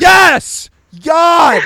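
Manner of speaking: high, loud exasperated yell; pissed-off shout. Retold or imitated. Sleeper asked if he's getting up, pissed shout. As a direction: explode at being nagged awake. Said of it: "you're just so pissed".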